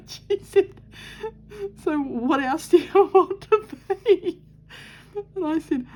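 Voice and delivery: can barely get the words out for laughing; recalling her mother's question, laughing hard; laughing through it. Real, not acted. Laughing while retelling mother.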